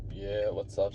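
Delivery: low, soft and laid-back; understated chill-guy voice; chill, calm. Acted. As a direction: toss it off quietly, like a mellow greeting. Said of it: "that chill, like, calm self".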